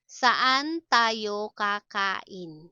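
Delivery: drawn-out, separated syllables; slow teaching model. Shown as a demonstration. Teacher models a question about eating out, slowly. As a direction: sound each word out carefully.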